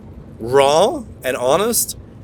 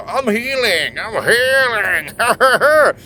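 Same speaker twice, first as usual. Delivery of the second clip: drawn-out, higher mock chant of healing, slowing on the repeat; mocking self-help voice; 6 semitones higher, much slower than usual. Mocking people who claim to be healing, drawn-out higher chant. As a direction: parody someone who keeps telling you they're healing. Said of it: "Most of these people are just".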